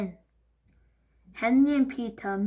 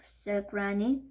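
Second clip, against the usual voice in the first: ordinary delivery, nothing marked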